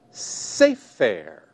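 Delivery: sefer with the accent on the first syllable, say; stress on first syllable. Shown as a demonstration. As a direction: lean on the opening "say" of sefer. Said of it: "is the first syllable of the word".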